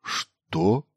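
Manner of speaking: rising, incredulous what; taken aback; stunned question. A narrator's reading. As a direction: ask it in disbelief. Stunned reply to sudden conscription.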